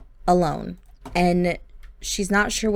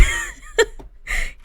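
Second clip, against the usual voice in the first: a real squeal of laughter; high breathy laugh. Real, not acted. Player laughing at the husband's antics, a high squeal of laughter.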